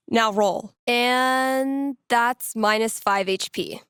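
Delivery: a touch of a gleeful dungeon master narrating the danger; game master. Acted. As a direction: play the DM, relishing the player's bad spot. Plays game master to friend.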